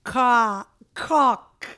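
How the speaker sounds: halting attempt at caulk with the L lost; struggled pronunciation. Shown as a demonstration. Guest attempts caulk for hosts, struggling pronunciation. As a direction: try caulk, break off, land on "cock". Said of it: "I also can't say that sealant thing".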